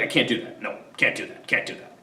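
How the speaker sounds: soft, sinking refusal, fading to a mutter; sulky refusal. Retold or imitated. Son refusing his mother's advice, sulky and low. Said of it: "Coriolanus is like".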